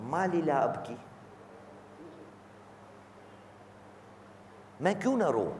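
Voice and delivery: soft, mournful quoted supplication; retold weeping lament. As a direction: voice the Imam's grief in a hush. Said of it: "जारो कतार रो रहे हैं".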